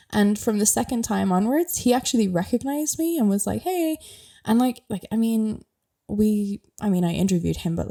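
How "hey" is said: high, breezy hello imitating a star; a cheery celebrity hi, high up; lightly voiced friendly actor greeting. Voicing Colin Farrell's greeting.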